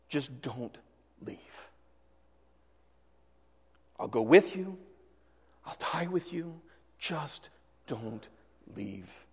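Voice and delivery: hushed and pleading, almost breaking off; desperate plea. Retold or imitated. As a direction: Peter begging Jesus to stay, soft and desperate. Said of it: "hear the desperation in Peter's voice".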